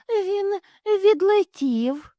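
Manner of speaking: high, slow, light; airy explanation. Acted. Mother hare tells the inspector, high and slow. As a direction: explain it innocently.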